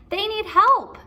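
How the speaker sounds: put-on high and loud young boy; eager kid calling out; Jack's voice. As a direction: pitch way up as the boy. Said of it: "said Jack".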